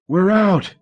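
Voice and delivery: high, loud cry of joy; joyful shout. A narrator's reading. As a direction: shout it out, near tears. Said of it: "he shouted, about to sob with joy".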